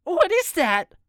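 high panicked shriek; screeching woman. A narrator's reading. Missus Borden sees the gun and screeches high. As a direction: screech it in sudden alarm. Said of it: "Missus Borden screeches".

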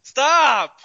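high, loud laughing protest; exasperated shout. Real, not acted. Friend begs him to stop moaning.

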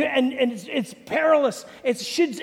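usual speaking voice

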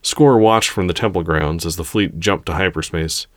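usual speaking voice

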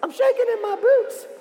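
very high, squeaky mock-scared voice; scared Israelites. Shown as a demonstration. Storyteller teaches kids the Israelites' line, mock terror. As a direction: quail in a comic falsetto. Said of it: "they were so scared".

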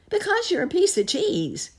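very high, loud rat voice; excited rat. A narrator's reading. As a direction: squeal the answer loudly. Rat answers the captive.

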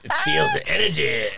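laughing. Real, not acted. Laughing at toy.

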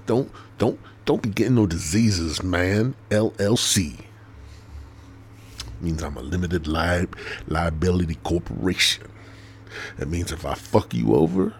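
usual speaking voice

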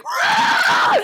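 a sudden high, loud mock roar; raptor roar. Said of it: "He's like".

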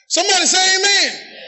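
normal talking voice

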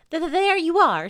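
high, loud, stuttering triumph; vindicated Piglet. A narrator's reading. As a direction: stutter it out in a little squeak.

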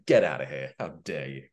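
mock offended. Acting offended dismissal.

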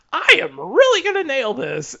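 high, loud burst of gung-ho enthusiasm; pumped-up impression, pitched high and loud; mock enthusiasm. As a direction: imitate someone utterly fired up. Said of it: "you know, that enthusiasm".

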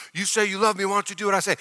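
imitating a reproachful spouse; demanding spouse. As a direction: needle your partner, lightly acted. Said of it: "Or we tell our spouse".

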